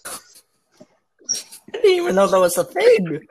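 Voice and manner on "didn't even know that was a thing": high squeaky disbelief; surprised. Pretend. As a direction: squeal it in mock shock. Mock shocked reaction.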